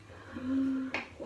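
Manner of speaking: sharp happy intake of breath; delighted gasp. Real, not acted. Delighted gasp at melting cheese.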